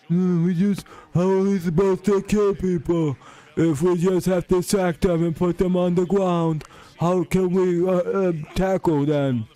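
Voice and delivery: high, whiny, put-on voice, slowing down; mocking high whine; mock whiny defender. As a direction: mimic a crybaby player whining. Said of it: "crying about everything".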